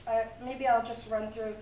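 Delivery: ordinary talk, nothing marked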